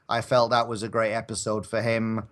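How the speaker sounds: normal talking voice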